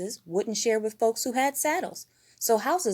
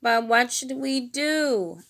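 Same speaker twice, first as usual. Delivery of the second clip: slow, fearful, timid; fearfully; 5 semitones higher, 4 dB louder, slower than usual. A narrator's reading. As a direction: ask it timidly, worried. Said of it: "asked Fozzie fearfully".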